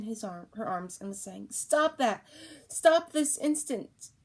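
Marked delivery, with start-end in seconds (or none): 1.5-4.1 higher and loud, slowed for the second order; mother scolding her shouting baby, loud; stern scolding. Acted. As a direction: order the child to stop. Mother scolding screaming child.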